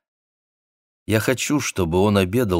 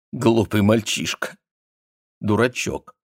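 muffled murmur into a shoulder; face in shoulder; 3 semitones higher than usual. Acted. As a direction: mumble it with face pressed in. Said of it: "пробормотала она, уткнувшись ему в плечо".

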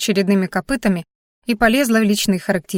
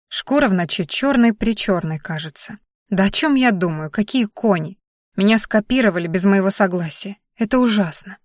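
ordinary delivery

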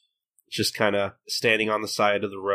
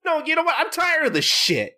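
mock snapping. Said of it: "finally snapping and being like".

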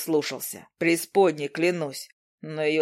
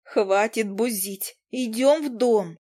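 higher, slower cross scolding; angry snap; 6 semitones higher, slower than usual. Acted. Izi scolding Demian to come inside, cross and higher. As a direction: scold him like a stern girlfriend. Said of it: "бросила сердито".

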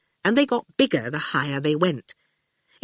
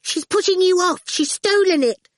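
put-on high-pitched child's loud accusation; loud, annoyed; 14 semitones higher, 3 dB louder than usual. A narrator's reading. Awful annoyed at getting no biscuits, loud accusation. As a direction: pipe up loudly and high, annoyed. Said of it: "said loudly".

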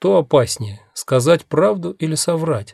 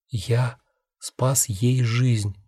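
soft, very slow reply; quiet; 6 dB quieter, much slower than usual. A narrator's reading.

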